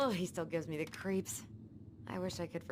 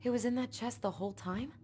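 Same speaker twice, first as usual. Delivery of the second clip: excited; 6 semitones higher, 3 dB louder than usual. Real, not acted. Finding the Book of Goblins.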